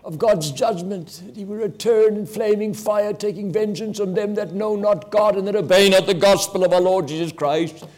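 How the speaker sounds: high, loud preaching with a ringing edge; fiery preaching. Acted. Preacher warning the congregation of God's judgment, fiery preaching. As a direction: thunder the judgment verse over the congregation. Preacher quoting scripture at full force.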